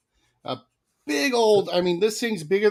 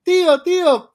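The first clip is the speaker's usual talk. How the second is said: put-on high, loud eager kid calling "tío"; mock kid calling out; 11 semitones higher, 7 dB louder than usual. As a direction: play the excited little boy calling to a stranger. Kid calls out in water.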